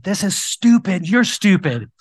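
loud, high, slow shouted insult; angry yell. Retold or imitated. Yelling at the guard from his window, loud and high. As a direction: hurl it out the car window.

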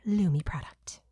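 whispered ad aside, all breath. Acted. To listeners about the Lumi prize, breathy whisper. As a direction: hush it like a secret giveaway. Ad read giveaway.

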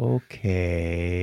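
low, soft, creepy okay. Retold or imitated. Skit character slinking off with a creepy okay. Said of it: "the first guy kinda goes away creepy".

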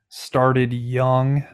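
normal talking voice

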